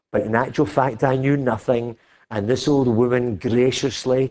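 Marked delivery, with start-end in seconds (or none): none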